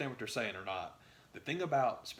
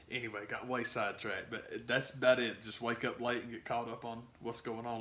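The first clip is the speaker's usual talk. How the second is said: usual speaking voice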